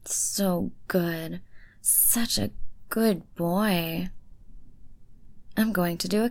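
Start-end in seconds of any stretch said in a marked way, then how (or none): 0.1-4.1 unvoiced whisper, slow and drawn out; whispered praise. Praising her good boy in a slow whisper. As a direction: breathe the praise slowly into his ear.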